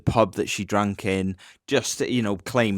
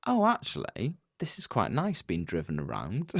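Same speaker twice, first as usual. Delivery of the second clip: mock realisation; 2 semitones lower, 7 dB quieter than usual. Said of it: "I'm only joking".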